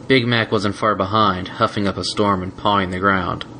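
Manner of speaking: ordinary delivery, nothing marked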